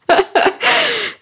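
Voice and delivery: short, high, relieved laugh. Real, not acted. Laughing at herself for fleeing the train, relieved. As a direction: laugh off the embarrassment.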